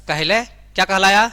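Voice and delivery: loud rising question on कहलाया; quizzing class. Shown as a demonstration. Teacher quizzing students loudly. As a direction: call out to the class for the answer. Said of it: "बिल्कुल, लिख लीजिए".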